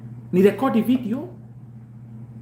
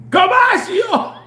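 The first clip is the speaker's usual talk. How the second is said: drawn-out, higher, louder cry; slow high shout; 13 semitones higher, 6 dB louder, much slower than usual. Real, not acted. Shouting to the listeners in slow triumph. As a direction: wail the word out slowly.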